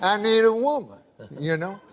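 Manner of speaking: put-on low, drawling Southern old-timer voice telling a joke; Southern accent. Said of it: "I can tell by the accent".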